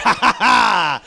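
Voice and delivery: put-on cocky ha-ha-ha; mock boastful laugh. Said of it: "He's all cocky".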